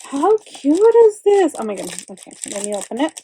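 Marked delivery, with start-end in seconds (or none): 0.1-1.6 excited gushing. Real, not acted. Gushing over a gift.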